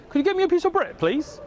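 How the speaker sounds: mock British English accent. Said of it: "In England, they say".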